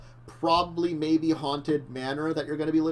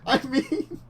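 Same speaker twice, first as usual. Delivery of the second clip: laughing; 11 semitones higher, 5 dB louder, slower than usual. Real, not acted. Laughing about haunted manor.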